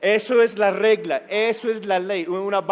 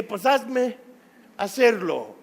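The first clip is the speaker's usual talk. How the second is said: defiant dare; 4 semitones higher, slower than usual. Retold or imitated. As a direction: throw the dare back at him. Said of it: "Yo le dije".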